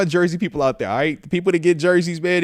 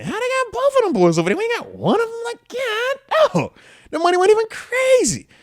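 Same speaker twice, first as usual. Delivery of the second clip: disbelief. Real, not acted. Venting about Giants signing quarterbacks.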